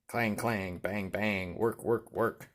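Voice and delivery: usual speaking voice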